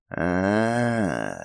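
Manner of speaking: slow detached serpent hiss. Acted. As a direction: hiss it out, aloof. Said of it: "прошипел с некоторой отстранённостью дух".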